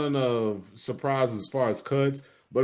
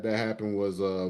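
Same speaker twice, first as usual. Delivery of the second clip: ordinary talk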